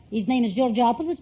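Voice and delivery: usual speaking voice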